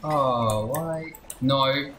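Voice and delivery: usual speaking voice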